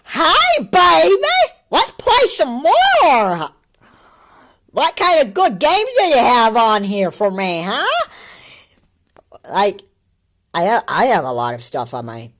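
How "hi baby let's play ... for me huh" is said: very high, loud hammed-up cooing; mock baby talk. Reactor pretending to greet the Switch, exaggerated high baby talk. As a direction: gush at it like it's your baby. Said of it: "face my Nintendo Switch and say".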